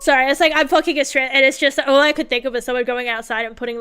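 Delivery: normal talking voice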